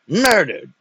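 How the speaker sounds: loud, high accusing cry; theatrical croak of outrage; croaked outcry. A narrator's reading. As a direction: cry it out like a wronged old man. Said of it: "croaked Iverson Lord".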